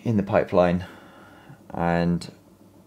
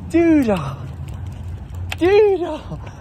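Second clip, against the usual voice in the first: calling out; much slower than usual. Real, not acted. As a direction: shout the dog's name up high. Calling the dog out loud.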